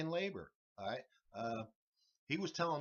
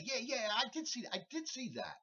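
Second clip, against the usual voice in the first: eager yes; 10 semitones higher than usual. Retold or imitated. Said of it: "jump in and say".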